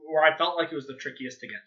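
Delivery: usual speaking voice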